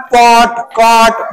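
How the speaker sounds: slow पॉट, कॉट with a round open ऑ; slow vowel demo. Shown as a demonstration. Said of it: "ऑ कुठं म्हणणार?".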